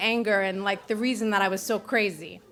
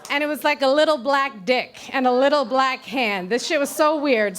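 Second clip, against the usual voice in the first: ordinary delivery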